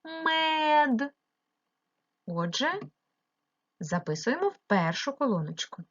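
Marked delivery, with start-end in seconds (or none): 0.0-1.1 мед with the vowel stretched long and high; мед sung out on one long, high е; stressed check word. Shown as a demonstration. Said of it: "поставити його під наголос".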